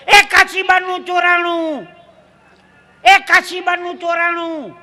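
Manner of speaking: high, drawn-out alarm call; imitated night cry. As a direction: call it out slowly like a night hawker. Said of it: "બૂમ પાડતો હતો". (ઊંચા સાદે લંબાવેલી બૂમ)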